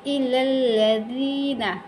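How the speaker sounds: इलल्लज़ीना slowly, doubled l, long ee, falling; recited Quranic reading. Shown as a demonstration. Teacher models the word, slow recitation. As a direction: hold the zee in इलल्लज़ीना. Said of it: "क्या पढ़ेंगे".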